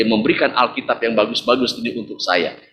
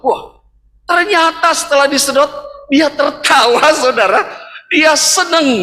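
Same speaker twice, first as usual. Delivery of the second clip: much higher and louder with delight; excited; 12 semitones higher, 4 dB louder than usual. Real, not acted. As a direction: burst out happily. Joy when the baby laughs.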